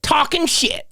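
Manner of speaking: high-pitched indignant imitation; mock yelling. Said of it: "He's yelling at them".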